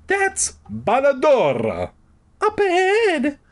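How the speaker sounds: very high, slow put-on woman voice; squeaky high character voice; Carolyn's new voice. A narrator's reading. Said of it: "Carolyn announced in her new voice".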